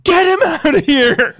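high, loud shriek with a laugh breaking in; doubling down yell. Retold or imitated. Mom doubling down on the noisy kid, laughing shriek. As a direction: scream it, half laughing at yourself. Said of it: "my mom doubles down".

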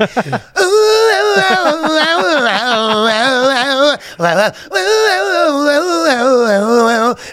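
high sung falsetto wail; mock falsetto wail. Pretending to sing into a voice note, long high wail.

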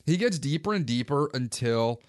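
normal talking voice

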